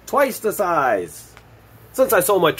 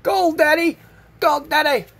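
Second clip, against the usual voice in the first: high-pitched mock child yelling, much higher; imitating excited kid; 8 semitones higher, slower than usual. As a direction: squeal it like a little boy finding gold. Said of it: "his son scream and yelling".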